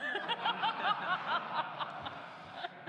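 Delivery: high, loud self-conscious burst of laughter; embarrassed laugh. Real, not acted. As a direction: laugh at your own joke, sheepishly. Self-conscious laugh after joke.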